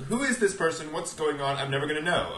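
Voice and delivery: high-pitched bewildered complaint; mock exasperation. Would-be reader picking up Spider-Man, mock exasperated. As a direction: throw up your hands in mock despair. Confused comic buyer, acted reaction.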